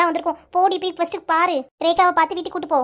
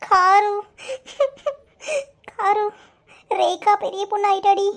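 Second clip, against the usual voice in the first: sobbing between words, voice upset; tearful; slower than usual. Acted. Tulasi breaks the news to her friend Karu, tearful. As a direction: choke out the news through sobs. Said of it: "ஏய் துளசி, அழுறியா?".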